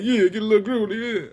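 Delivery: light imitation of Will's Philly accent. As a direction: loosen up and play Will being groovy. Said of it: "Will be talking like, like that".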